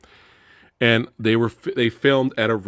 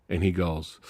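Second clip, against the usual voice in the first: ordinary talk, nothing marked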